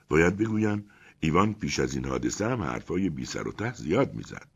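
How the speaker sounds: plain reading voice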